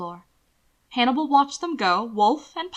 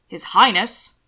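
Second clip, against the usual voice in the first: firm, louder "His Highness"; repressive correction; 6 dB louder, slower than usual. A narrator's reading. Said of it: "repressive in tone".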